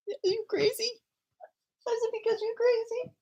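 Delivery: mock baby talk. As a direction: tease her in a high sing-song. Mother teasing crying child.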